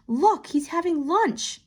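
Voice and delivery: put-on a child sharing a small discovery; delighted little girl. A narrator's reading. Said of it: "added Theo".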